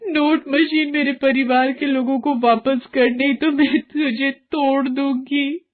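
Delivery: high, slowing to a hard final warning; tearful threat. Acted. Varsha threatening the money machine to return her family.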